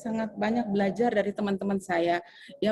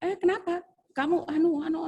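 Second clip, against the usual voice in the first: higher, scolding senior teacher; mock scolding; 10 semitones higher than usual. Senior teacher nags the new teacher, mock scolding and higher. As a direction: nag her about wasting. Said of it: "sudah macam-macam dia bilang".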